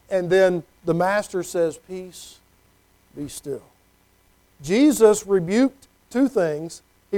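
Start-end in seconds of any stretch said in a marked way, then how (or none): 1.8-3.6 soft, low, hushed command; quiet the storm gently; gentle hushed authority; calm command. Acted. Jesus calming the storm.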